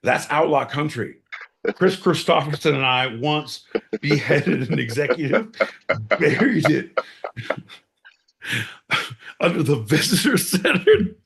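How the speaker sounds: normal talking voice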